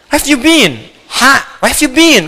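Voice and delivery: English phrase. Shown as a demonstration.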